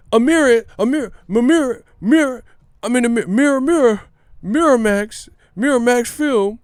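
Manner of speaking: high, loud, overexcited stammering; Tim Bradley, hyped stutter. Retold or imitated. Mocking a commentator struggling to say Ramirez, hyped stutter. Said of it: "Tim Bradley gets too hyped".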